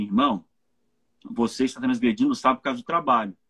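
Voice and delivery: sober, low-pitched appeal to a brother; caring correction. Acted. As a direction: gently confront him, low and deliberate. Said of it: "É eu chegar pra ele e falar assim".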